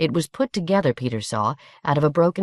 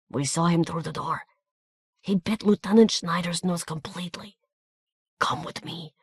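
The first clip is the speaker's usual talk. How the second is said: whispered Hungarian urgency; hushed; 3 semitones higher, 4 dB quieter than usual. A narrator's reading. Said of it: "a long whispered commentary".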